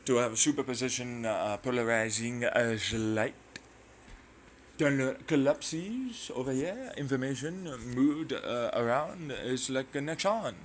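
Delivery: hammed-up French accent; put-on French accent. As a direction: lecture in a silly fake French accent. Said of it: "I don't do this accent".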